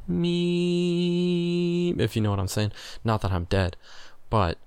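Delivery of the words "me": high, steady flatline drone. Shown as a demonstration. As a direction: hold one high note like a flatline. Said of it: "The way I've been feeling lately is just like".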